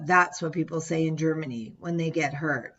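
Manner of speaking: ordinary delivery, nothing marked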